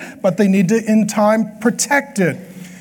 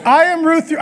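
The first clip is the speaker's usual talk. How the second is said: higher mock young woman; hammed-up girl voice; 9 semitones higher than usual. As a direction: try the girl voice again, lighter. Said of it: "I don't do a good girl voice".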